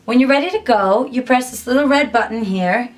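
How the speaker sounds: usual speaking voice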